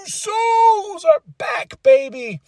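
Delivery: high, drawn-out celebratory cry; excited. Retold or imitated. Fan hailing the Usos' return, excited cry. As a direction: stretch it out in a high-pitched cheer. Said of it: "I got excited".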